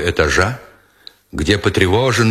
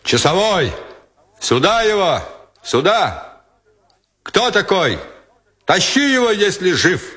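higher, forceful shouted orders; bellowing commander; 8 semitones higher than usual. A narrator's reading. Battalion commander ordering the sentry to drag in the intruder, bellowing. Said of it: "заревел бас комбата Гранатурова".

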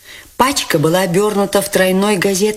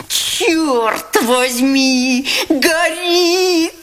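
much higher, slow panicked cry; alarmed outcry; 12 semitones higher, much slower than usual. Acted. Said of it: "вскричал он, увидев пламя". (испуганный высокий вскрик)

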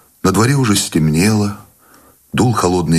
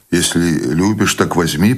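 ordinary delivery

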